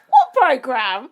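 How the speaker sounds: slow, loud, drawn-out disbelief; shocked question. Real, not acted. Cohost hearing a gross story, slow loud shocked question. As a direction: stretch it out in stunned disbelief.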